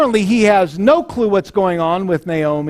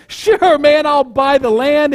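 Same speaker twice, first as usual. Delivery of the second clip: much higher, eager "Sure, man"; eager agreement; 7 semitones higher, slower than usual. Retold or imitated. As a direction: jump at it like a man seeing dollar signs. Eager buyer agrees at once.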